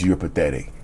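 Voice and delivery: low, grim inner voice. Retold or imitated. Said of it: "the only thing you hear was".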